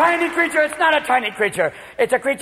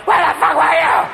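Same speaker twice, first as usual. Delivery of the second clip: loud, higher angry demand; furious wife; 5 semitones higher, 5 dB louder than usual. Retold or imitated. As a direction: snap at him from the doorway. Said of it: "waiting for you in the doorway like".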